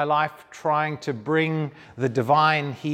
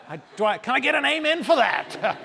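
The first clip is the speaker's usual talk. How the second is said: a playful preacher asking for an amen, pitched much higher; hammed-up church call, higher; mock preacher call; 10 semitones higher than usual. Guest speaker calling the crowd for an amen, mock preacher.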